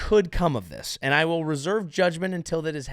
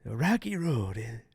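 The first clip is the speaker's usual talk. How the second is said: slow, drawn-out mumbled impression; 4 semitones lower, 6 dB quieter than usual. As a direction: drag it out as a mock impression. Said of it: "walking around going".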